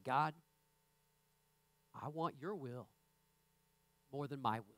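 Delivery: low, hushed, unhurried plea; soft earnest prayer. Shown as a demonstration. Preacher showing the congregation a quiet prayer. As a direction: pray it softly from the heart. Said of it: "You say".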